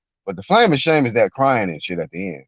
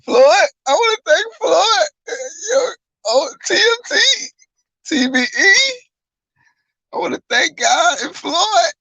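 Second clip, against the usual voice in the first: mock sobbing speech. Mocking the crying winner thanking Floyd, high and sobbing.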